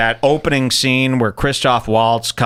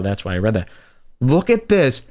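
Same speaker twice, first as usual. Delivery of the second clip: ordinary talk, nothing marked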